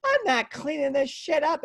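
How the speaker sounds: high-pitched mock outrage; flipped-out mom. Mom refusing her husband's request, mock fury. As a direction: imitate a mother losing it, voice way up. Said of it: "she's flipped out on my dad".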